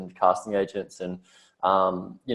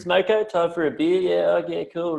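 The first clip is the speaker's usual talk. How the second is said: higher, lazy laid-back builder; lazy Aussie tradie; 8 semitones higher than usual. Retold or imitated. Builders putting off the job, lazy carefree Aussie. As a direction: stretch it out like work can wait. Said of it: "everyone's just like".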